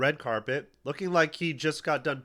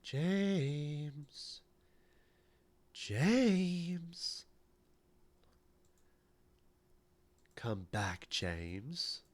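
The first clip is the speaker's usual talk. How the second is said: eerie cats calling; 2 semitones higher, 6 dB quieter, much slower than usual. Retold or imitated. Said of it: "They said".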